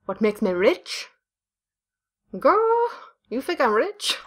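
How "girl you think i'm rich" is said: sassy. Pretend. Sassy retort.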